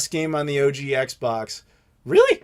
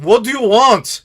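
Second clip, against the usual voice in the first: slow, higher and louder curt snap; angry clerk; 6 semitones higher, 6 dB louder than usual. Retold or imitated. Angry hotel clerk brushing off a tired traveler, slow irritated snap. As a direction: bark it at the guest like an annoyed clerk. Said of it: "just angry lady behind the counter".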